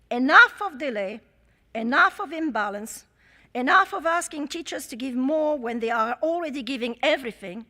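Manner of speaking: each enough punched, the first loudest; rallying repetition. Real, not acted. To the conference delegates, rousing stress on each enough. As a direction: hammer every enough home, building from a shout.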